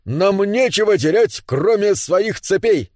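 high rally cry, slowing down; rousing slogan. Acted. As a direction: declaim it, stretching the final words. Dwarves chant a rallying slogan. (лозунг, нараспев на митинге)

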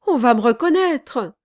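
loud, high cry of alarm; cried out. A narrator's reading. Charlot fearing he will be recognised, crying out. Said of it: "cria Charlot".

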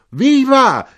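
very high, very loud cheer; drunken shout. A narrator's reading. Drunkard joining the cheer, shouting. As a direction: yell it like a rowdy drunk. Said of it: "gridò un ubriaco".

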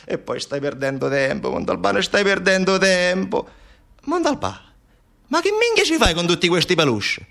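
high, incredulous scolding; exasperated colleague. Acted. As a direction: chide a friend for wasting time. Colleague scolds Montalbano over plush toys.

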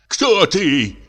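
high, sharp cry in a voice not his own; sharp frightened cry. A narrator's reading. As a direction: cry out in terror at the figure. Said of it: "крикнул он резким, не своим голосом".

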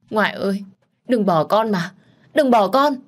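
loud high wail, rising to a scream; screaming plea. A narrator's reading. Reaching out as grandmother vanishes, the girl screams for her, loud and high. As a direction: scream for grandmother not to leave. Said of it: "Tôi đưa tay mà gào thét".

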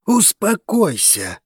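high, slow, insistent urging; dragon calming friend. A narrator's reading. Dragos to his crazed friend, high slow urging. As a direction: draw it out high to calm him. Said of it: "произнёс дракон обезумевшему другу".

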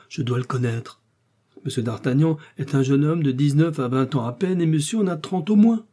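lightly voiced protesting landlord; a touch of flustered argument; insistent Bonacieux. A narrator's reading. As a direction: press the point to the official. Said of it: "s'écria à son tour monsieur Bonacieux".

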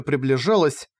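plain reading voice

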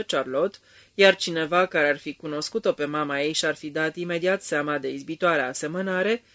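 usual speaking voice

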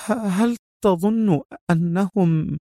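high, slow, stammering fearful question; trembling voice. A narrator's reading. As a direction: ask haltingly, trembling with fear. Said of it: "سألته في صوت مرتجف".

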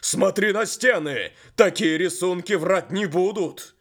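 loud, high commanding shout; loud insistence. Acted. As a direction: make him look at the walls. The horse insists angrily. (громкий приказ коня)

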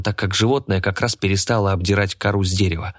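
ordinary delivery

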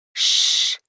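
rustling, unvoiced shush; Шрип. A narrator's reading. Rope hushes the raging Карло, rustling whisper. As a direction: hiss a long hush. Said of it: "прошелестела верёвка Шрип".

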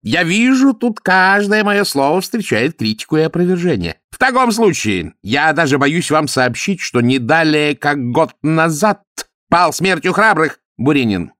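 lightly voiced swindler Ametistov. A narrator's reading. Ametistov needling Zoya, lightly voiced rogue. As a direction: play the boastful cousin, lightly.

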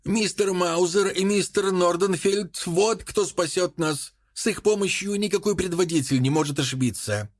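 high-pitched, emphatic declaration, no real shout; crying out. A narrator's reading. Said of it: "крикнул Энсли". (приподнятый возглас без крика)

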